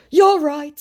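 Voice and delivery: high, loud mock agreement; scammer's put-on voice. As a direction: pitch it up as the scammer. Acts scammer's reply.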